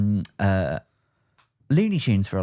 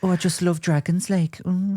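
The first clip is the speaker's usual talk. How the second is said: high-pitched northern queen; 7 semitones higher than usual. Acted. Singer imagined as fantasy queen of the north, high mock-regal impression. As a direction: put on a high, gushing pop-star queen. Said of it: "[name] as the queen".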